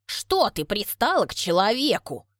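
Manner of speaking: usual speaking voice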